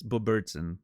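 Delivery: ordinary delivery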